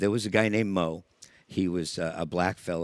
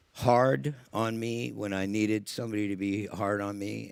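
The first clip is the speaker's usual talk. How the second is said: ordinary delivery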